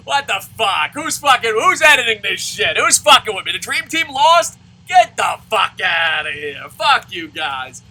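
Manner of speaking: loud furious shouting at the screen; furious fan shouting that his team lost; outraged yell. Retold or imitated. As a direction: yell at the TV in disbelief. Fan raging at the TV.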